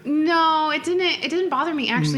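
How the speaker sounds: high, loud and quick to deny; insist, pitch jumping up; emphatic denial. Real, not acted. Denying jealousy.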